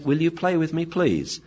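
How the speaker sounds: lightly voiced little beggar; pleading dog. Dog asking to be played with, gently pleading. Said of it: "has come up and saying".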